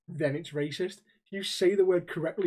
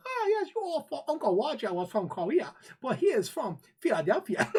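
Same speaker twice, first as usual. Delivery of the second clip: put-on Uncle Roger accent; 6 semitones higher than usual. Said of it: "he proper rips into people and goes".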